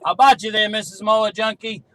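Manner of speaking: normal talking voice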